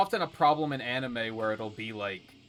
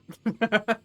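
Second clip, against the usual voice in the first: laugh; 8 semitones higher, 6 dB louder than usual. Real, not acted. Laughs at chat comment.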